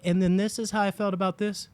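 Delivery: high-pitched mock presenter; YouTuber imitation. Mocking quick-cut YouTubers, high presenter voice. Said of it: "YouTubers that are like".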